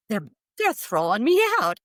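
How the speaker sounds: high, loud disbelief; dismayed protest. Retold or imitated. Being sent back from bliss, high dismayed protest.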